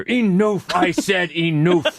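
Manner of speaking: hammed-up movie shout; mock yell. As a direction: bellow it like the movie scene. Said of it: "we'll just yell at each other".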